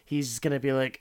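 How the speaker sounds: usual speaking voice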